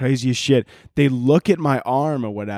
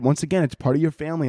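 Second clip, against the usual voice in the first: ordinary delivery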